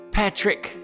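ordinary talk

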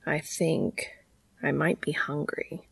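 soft, hesitant near-murmur; subdued admission. Acted. Cass weakly admits to Dean he's hungry, softly. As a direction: keep it small and tentative.

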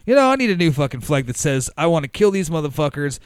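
loud, fast, excited pirate captain. Retold or imitated. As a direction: bark an order for a gory flag. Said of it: "the Captain Pirate guy was like".

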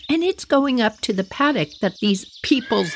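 usual speaking voice

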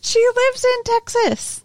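very high, squealed protest; high-pitched exasperated objection; exasperated insistence. Real, not acted. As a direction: repeat it way up high, incredulous. Repeats her objection, incredulous.

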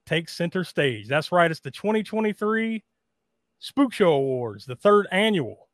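dramatic scripted intro read; awards show host. Acted. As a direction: read it like a spooky emcee.

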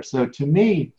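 usual speaking voice

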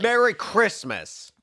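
high, slow, loud hammy cheer; loud festive greeting. Acted. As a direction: bellow it like a Christmas card.

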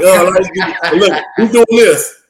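mock the coach grilling the class; nerdy coach quiz. Mocking Van Gundy quizzing players at the projector, nerdy teacher voice.

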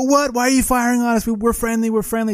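high-pitched, incredulous retold outcry; mock indignant protest. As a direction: protest your innocence to the attackers. Retold protest at being fired on.

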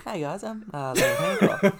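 silly contestant voice. Pretend. Contestant intro in put-on voice.

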